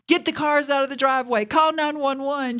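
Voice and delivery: orders rattled off high, with authority; barking orders. Retold or imitated. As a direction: command like a first responder. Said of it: "clicking off orders to everybody".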